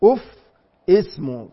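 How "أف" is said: أف blurted high and loud as an exasperated sigh; أف thrown out high and sharp in annoyance; voicing irritation. Shown as a demonstration. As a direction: say أف like a fed-up huff. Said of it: "ما معنى أف".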